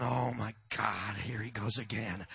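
low, slow weary groan; exasperated wife. Retold or imitated. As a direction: imitate a long-suffering wife under the breath. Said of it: "My wife's going".

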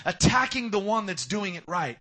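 loud, ringing preacher's refrain; loud emphatic refrain. Acted. Preacher pressing his point on the congregation, loud. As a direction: hammer the line home.

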